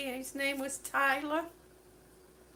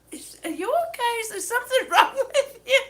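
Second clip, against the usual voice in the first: fast and high, laughing while quoting; laughing through the quote; 7 semitones higher, 6 dB louder, faster than usual. Retold or imitated. Quoting her husband while laughing. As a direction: rush it out, still laughing. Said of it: "he said".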